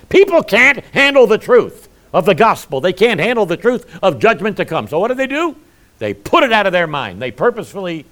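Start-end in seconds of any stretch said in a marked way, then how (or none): 0.1-4.9 loud, high-pitched exhortation; forceful preaching. Real, not acted. Preacher warning the congregation about denial, loud and forceful. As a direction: drive it home at full preaching volume.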